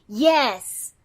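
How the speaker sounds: loud, firm, angry yes; pissed off. Acted. Nikki shutting down her partner, pissed off and loud. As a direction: slam the door on any argument. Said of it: "is really pissed off on behalf of Cecil".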